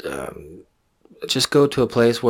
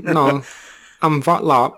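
alien voice; 4 semitones higher, much slower than usual. Pretend. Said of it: "trying to be Christopher Walken".

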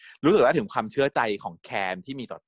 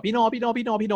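much higher, excited calling out; clamoring; 13 semitones higher than usual. Retold or imitated. Can clinging to Phi No's neck, clamoring. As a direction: whine his name over and over. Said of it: "โวยวายว่า".

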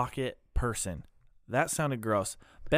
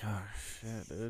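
much softer, deflated disbelief; stunned quiet; 3 semitones lower, 14 dB quieter, slower than usual. Retold or imitated. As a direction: mutter it like you just left a bleak movie. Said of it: "Everybody walks out of the theater like".

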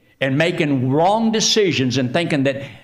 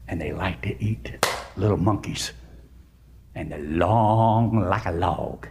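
hushed, low storybook menace with long vowels; ominous crocodile warning; 8 semitones lower than usual. Acted. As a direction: draw it out to spook little kids. Giraffe warns monkey about crocodiles.

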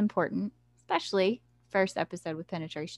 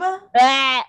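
loud disgusted gag sound; disgusted blech; 6 semitones higher, 11 dB louder than usual. Real, not acted. Reacting to crude book words.

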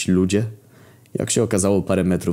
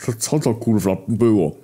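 frightened; 4 semitones higher than usual. A narrator's reading. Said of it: "przeraził się Głód".

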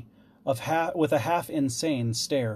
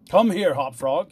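lightly voiced king, raised and louder; commanding king; 4 semitones higher, 7 dB louder than usual. A narrator's reading. King calls his jester over, commanding. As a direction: summon the jester with authority.

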